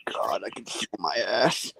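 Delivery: unvoiced, weary whisper. Real, not acted. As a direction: breathe it out tired. Groaning whisper.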